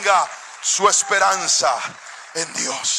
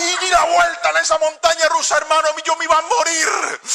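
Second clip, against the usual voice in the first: much higher, breathless telling; excited retelling; 8 semitones higher than usual. Retold or imitated. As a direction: relive the terror at full pitch.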